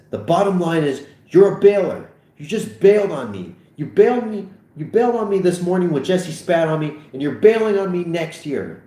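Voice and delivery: loud, high, heated accusing; worked-up and aggrieved; angry accusation. Acted. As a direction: hammer the accusation home. Acted quarrel, accusing his friend.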